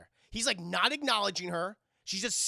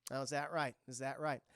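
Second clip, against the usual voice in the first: mock skepticism; 5 semitones lower, 5 dB quieter than usual. Blonde woman doubts secretary's cover story.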